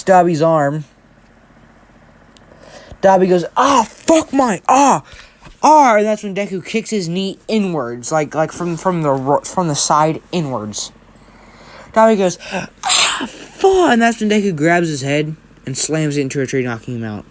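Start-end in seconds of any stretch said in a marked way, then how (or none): 3.5-6.0 loud, high cries of agony; acted pain cries. Dobby's arm is broken. 12.5-13.9 acted pain scream. Dobby's knee kicked inwards.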